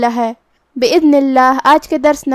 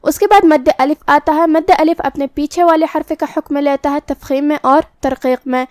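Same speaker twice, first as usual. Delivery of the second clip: normal talking voice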